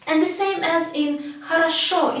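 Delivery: normal talking voice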